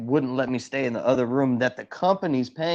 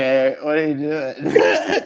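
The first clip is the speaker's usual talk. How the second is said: casual hey; 5 dB louder, slower than usual. Pretend. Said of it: "call her up right now and be like".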